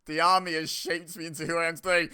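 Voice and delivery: mock dramatic recital. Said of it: "That editing was amazing".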